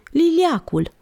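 high, understated shriek of fright; shuddering cry. A narrator's reading. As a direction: cry out high, with little added volume. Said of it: "țipă ea, scuturându-și fiorii". (țipăt speriat, pe ton înalt)

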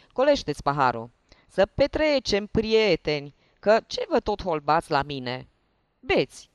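loud, hearty tipsy host; jovial drunk. Acted. Hiding lieutenant welcomes the investigators, loud tipsy cheer. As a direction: urge them to drink, boisterously.

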